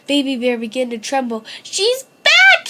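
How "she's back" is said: high, loud frightened wail; high cub wailing in fear. A narrator's reading. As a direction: cry out in dread. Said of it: "he wailed".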